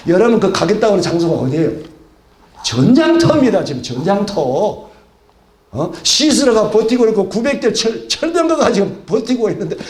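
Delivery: ordinary delivery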